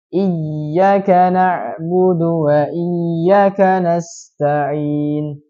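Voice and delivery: iyyaka read with the ya cut short, slow chant; teacher shows iyyaka with no long vowel on ya; wrong short ya. Shown as a demonstration. Teacher shows students the wrong short reading. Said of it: "Nah, dia pendekkan".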